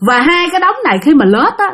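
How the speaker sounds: plain reading voice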